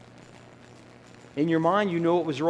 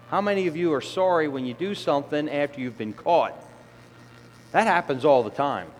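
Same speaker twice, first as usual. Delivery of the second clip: ordinary delivery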